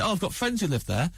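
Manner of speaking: high, bright-eyed hostess offer, lightly done; chirpy mum voice. Retold or imitated. Comedian imitating a friend's mum offering breakfast, high sing-song.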